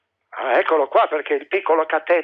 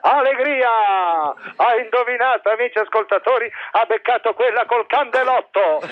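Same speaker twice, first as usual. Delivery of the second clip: excited game show host; italian quiz host; 3 semitones higher than usual. Acted. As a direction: announce it like Mike Bongiorno.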